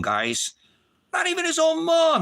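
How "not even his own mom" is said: anguished cry. Real, not acted. Repeated lament.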